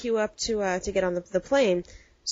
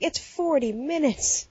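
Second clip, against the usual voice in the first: exasperated disbelief; 7 semitones higher, slower than usual. Retold or imitated. Exasperated reply to fussing friend.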